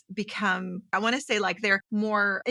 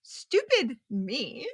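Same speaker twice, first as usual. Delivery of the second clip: rueful self-reproach; much slower than usual. Real, not acted. Bitter self-reproach looking back.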